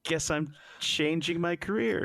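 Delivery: high, thin mock voice giving in; deflated ensign. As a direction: play a crushed nerd caving in. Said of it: "And he goes".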